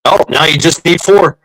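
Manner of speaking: ordinary delivery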